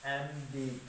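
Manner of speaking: letter by letter, drawn out for students; slow spelling. Shown as a demonstration. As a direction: spell each letter very slowly. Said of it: "थम". (अक्षर-अक्षर धीरे-धीरे)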